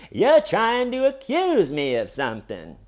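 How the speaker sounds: high, loud mock outrage; movie-style indignant suspect. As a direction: play the wronged innocent, hammed up. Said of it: "he's doing the movie".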